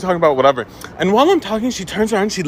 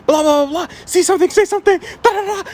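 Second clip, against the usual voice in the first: high-pitched imitation of a screeching complainer; hammed-up high tirade, little real volume; mock screaming lady; 10 semitones higher than usual. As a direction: rant way up high, mocking her. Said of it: "she starts screaming at him".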